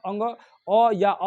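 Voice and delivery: अ said slow and drawn out; slow vowel sounds. Shown as a demonstration. Teacher sounding out अ slowly for students. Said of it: "क, ख, ग".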